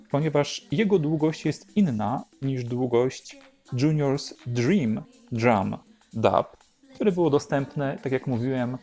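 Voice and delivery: ordinary delivery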